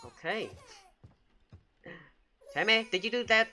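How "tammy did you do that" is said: high, loud questioning; alarmed accusation. Real, not acted. Babysitter accusing Tammy after a scare, alarmed.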